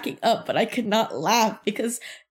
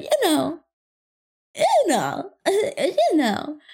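higher-pitched mocking imitation; mimicked insinuation; 8 semitones higher, slower than usual. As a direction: mimic him with a leading, drawn-out you know. Mimicking coworker's repeated phrase.

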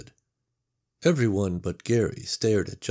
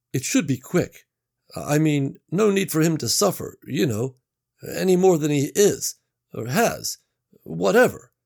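higher, hesitant worker voice; uneasy coworker Gary; 8 semitones higher, 5 dB louder than usual. Acted. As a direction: talk around it, uncomfortable. Gary uneasily suggests killing.

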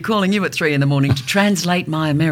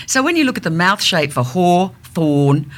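usual speaking voice

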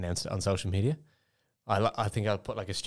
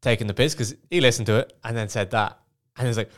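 ordinary delivery

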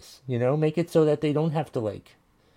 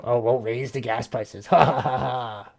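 hammed-up plotter with a fake villain laugh; mock schemer. Host playing Republicans plotting to raise gas prices, mock gloating. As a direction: gloat like a pretend villain, ending in "ha, ha, ha".

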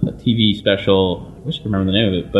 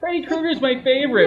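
put-on high, proud kid's voice; little boy. Kid telling the actor his favorite, high and proud. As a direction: boast like a small child.

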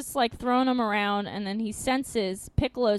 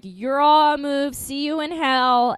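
villain taunt; 3 semitones higher, 7 dB louder than usual. Retold or imitated.

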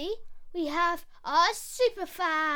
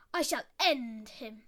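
growly lion; 7 semitones lower than usual. Acted. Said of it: "a growly one for Lion".

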